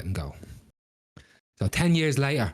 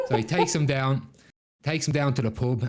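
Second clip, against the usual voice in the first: ordinary delivery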